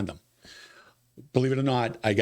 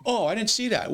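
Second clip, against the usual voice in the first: mock clueless voter; 11 semitones higher than usual. Voter voiced in retold exchange.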